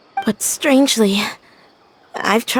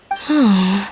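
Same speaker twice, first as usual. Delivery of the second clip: tired sigh; 4 semitones lower than usual. Acted. Girl waking from a dream, tired sigh. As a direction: let out a drowsy exhale.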